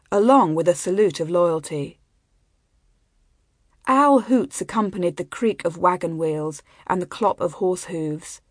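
usual speaking voice

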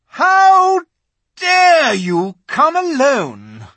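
high, loud, slow furious witch; the witch cries out in rage; terrible voice. A narrator's reading. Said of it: "said the witch in a terrible voice".

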